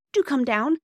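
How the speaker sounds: put-on high, loud, breathless-child urgency; excited cry. Bobby telling Mother about the injured boy, excited high cry. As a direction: pour out urgent news excitedly. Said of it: "she cried".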